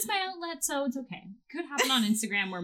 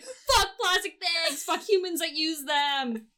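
slow, high, hammed-up outrage; mock angry rant; 6 semitones higher, slower than usual. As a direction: spit it out like an angry tweet. Voicing her own angry tweets.

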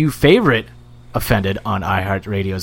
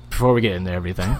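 rushed; 4 semitones lower, 5 dB quieter, much faster than usual. Real, not acted.

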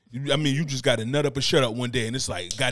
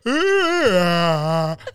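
drawn-out fake wail; mock crying. Comic showing bathroom crying to co-host, mock crying. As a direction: pretend to bawl for comedy. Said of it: "I'm just crying in the bathroom".

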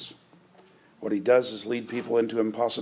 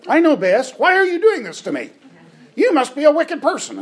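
put-on much higher, much louder bratty child protest; whiny three-year-old; 9 dB louder than usual. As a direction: wail it like a toddler denied his way. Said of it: "seen this with a three-year-old".

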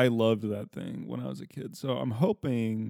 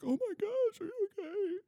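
very high, soft worried squeal; softly squeaked fretting over the baby; mock alarm; 5 dB quieter than usual. Mother fretting over bumped baby, high mock-worried voice. Mother reacting after bumping baby's head.

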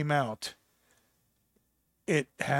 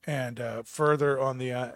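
ordinary delivery

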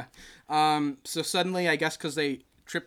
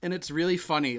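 usual speaking voice

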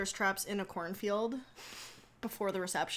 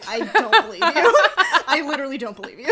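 laughing; 11 semitones higher, 18 dB louder than usual. Retold or imitated. Laughing disbelief at waiter.

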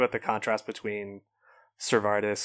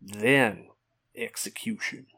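drawn-out Southern knight, last word breathed; slow menacing Southern drawl; a hint of a Southern drawl; slower than usual. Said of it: "put you on trial for that accent".

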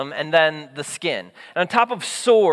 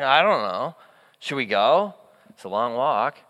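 low, subdued mock reluctance; hesitant; 6 semitones lower than usual. Lepers looking at each other, mock hesitant. Lepers voiced doubting the trip.

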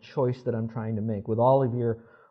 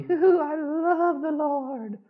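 high, slow, gushing "Hoo-hoo"; mock giddy gush; slower than usual. Preacher mocking a giddy, shallow love of the Lord. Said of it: "was not the".

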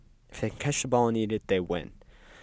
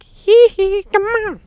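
loud, very high falsetto squeal; mock pop-star squeal up in falsetto; 13 dB louder, slower than usual. Pop star pesters everybody, in squealing falsetto.

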